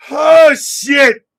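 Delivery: loud, high outburst; stunned exclamation. Real, not acted. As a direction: blurt it out loud in disbelief. Reacting to outrageous chat jokes.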